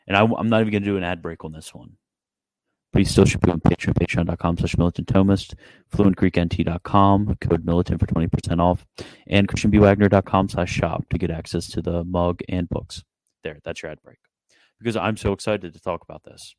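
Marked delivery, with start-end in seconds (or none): 2.9-13.0 fast, louder sponsor plug; quick ad read. Acted. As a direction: rattle off the ad quickly.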